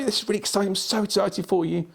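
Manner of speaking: very high-pitched, gushing excitement; high excited tone. Shown as a demonstration. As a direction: demo an over-the-top thrilled voice. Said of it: "that point of the top".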